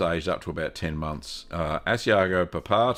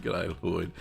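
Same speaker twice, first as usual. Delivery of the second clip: ordinary talk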